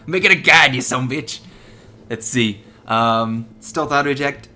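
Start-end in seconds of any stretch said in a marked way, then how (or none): 0.1-1.4 shouted joking insult; playful yell; loud mock insult. Joking insult at the assault chip.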